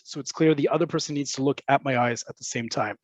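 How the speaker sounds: ordinary talk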